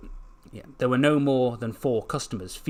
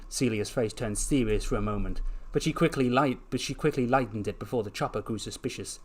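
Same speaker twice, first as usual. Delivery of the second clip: usual speaking voice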